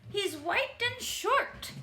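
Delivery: character voice. Acted. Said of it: "says Mister McGregor".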